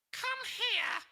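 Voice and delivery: high, loud cackling witch voice; shrill witch calling the boy over; sinister witch. Acted. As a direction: beckon like a grinning witch. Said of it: "said the witch with a sinister grin".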